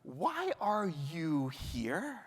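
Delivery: slow, soft, searching question as God; God's voice. Acted. Rabbi voicing God asking Elijah, slow and soft. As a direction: let God ask it gently and slowly. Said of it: "God's words, my intonation".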